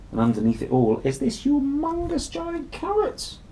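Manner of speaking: put-on showing children the giant carrot in awe; amazed reveal. A narrator's reading. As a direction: gasp it out in wonder.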